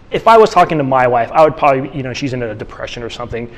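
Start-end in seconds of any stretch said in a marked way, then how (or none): none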